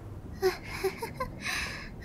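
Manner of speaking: light breathy titter; nervous giggle. Acted. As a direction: giggle it off softly. Nervous laugh while talking herself round.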